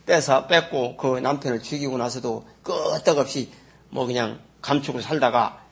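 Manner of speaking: normal talking voice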